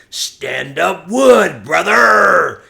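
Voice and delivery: booming, high, drawn-out shout; mock rallying shout. Inmate mocking a prison slogan, mock rallying shout. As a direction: holler it out like a hammed-up slogan.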